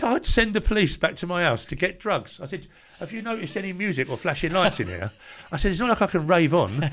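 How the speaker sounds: sarcastic comeback, pushed up high and loud; indignant. Retold or imitated. Retells arguing over withheld nasal spray.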